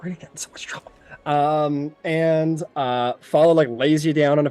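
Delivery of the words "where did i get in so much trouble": frightened whisper under the breath; terrified whisper. Acted. Terrified apprentice muttering to himself, whispered. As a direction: whisper it, scared of the mess. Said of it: "he's like".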